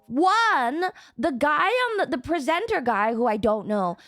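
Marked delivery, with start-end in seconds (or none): none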